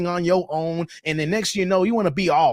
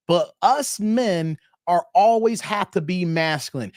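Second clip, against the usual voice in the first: ordinary talk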